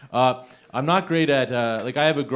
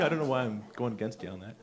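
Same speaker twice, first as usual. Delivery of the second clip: normal talking voice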